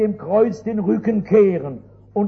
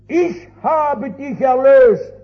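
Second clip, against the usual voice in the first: solemn proclamation; 4 semitones higher, 4 dB louder than usual. Retold or imitated. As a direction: proclaim it as Christ's promise. Jesus' words from the cross. (feierliche Verkündigung, langsam und laut)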